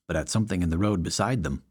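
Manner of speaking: ordinary delivery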